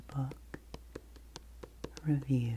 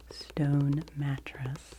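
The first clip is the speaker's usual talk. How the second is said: savored title; much slower than usual. Real, not acted.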